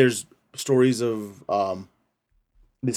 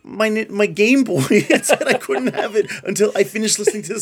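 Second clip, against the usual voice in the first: mock child voice; 10 semitones higher, 5 dB louder than usual.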